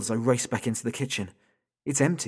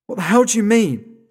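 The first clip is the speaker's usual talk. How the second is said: furious shout; 11 semitones higher, 12 dB louder than usual. Acted. Horrified father yelling.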